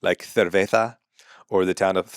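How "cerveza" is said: "cerveza" with TH for the C and Z; Castilian lisp. Shown as a demonstration. Explaining how Spain says it, Castilian lisp. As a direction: lisp both sibilants in "cerveza". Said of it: "they use a lisp on words with Z's and C's".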